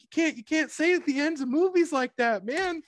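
high scolding lecture; scolding mock voice. Scolding the kid about spoilers, high mocking voice. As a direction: tell the kid off in a high squeak. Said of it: "He's like".

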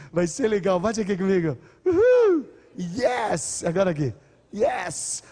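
a speaker firing up the audience at the end, cheering loudly; excited cheering. Real, not acted. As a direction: pump up the crowd with a loud whoop.

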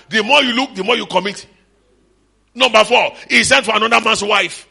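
normal talking voice

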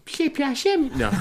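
very high, squeaky Przepraszam; squeaky comic voice. Pretend. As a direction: squeak it out like a cartoon. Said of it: "That was awesome".

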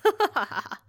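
loud, rough burst of laughter; raucous laugh. Acted. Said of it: "He let out a raucous laugh".

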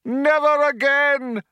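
high, loud, slow orator proclaiming; rally the crowd over a tannoy; crying out to crowd. A narrator's reading. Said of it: "cried the man".

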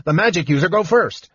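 high scolding gnome voice. A narrator's reading. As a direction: insist sharply, scolding.

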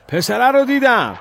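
very high, loud yell; shouted alarm. A narrator's reading. Villager alerts the searching crowd, shouting. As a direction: cry out to alert the whole crowd. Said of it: "یکی فریاد زد".

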